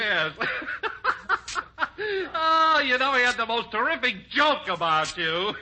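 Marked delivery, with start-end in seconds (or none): 0.4-2.3 high, helpless chuckling; amused laughter. Acted. Announcer recalling a joke about his boss, laughing. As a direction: laugh while remembering a good joke.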